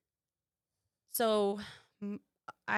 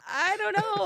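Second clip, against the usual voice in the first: helpless; 13 semitones higher, 6 dB louder than usual. Retold or imitated. Helpless reply to mother-in-law.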